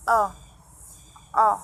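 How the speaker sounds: o diucapkan jelas dan terpisah; vowel demo. Shown as a demonstration. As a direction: model the o sound twice. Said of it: "Yang ketujuh". (bunyi o diulang jelas)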